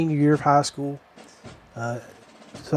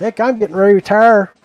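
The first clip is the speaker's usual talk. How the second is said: loud, quick, higher sergeant voice; imitated drill sergeant; 5 semitones higher, 5 dB louder, faster than usual. Veteran sergeant confides in recruit about retiring, imitated loud and quick. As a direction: announce it fast and loud as the sergeant. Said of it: "He said".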